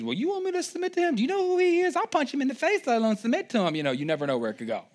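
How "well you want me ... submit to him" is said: high, fast mock outrage; hammed-up indignant wife voice. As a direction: squeak out an offended wife. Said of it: "a big old argument that ensues".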